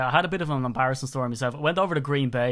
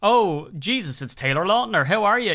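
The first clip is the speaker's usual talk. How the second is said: acted excited celebrity greeting, higher; mock starstruck greeting; 6 semitones higher, 4 dB louder than usual. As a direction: play at spotting a star. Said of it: "I'll do the thing of like".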